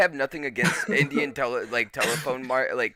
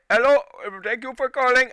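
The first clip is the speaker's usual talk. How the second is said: high, louder mock Indian call-centre accent; imitating insurance operator; 11 semitones higher, 5 dB louder than usual. Mocking the insurance company's phone operator, high put-on accent. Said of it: "I get".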